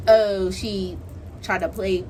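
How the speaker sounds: usual speaking voice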